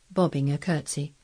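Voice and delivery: ordinary delivery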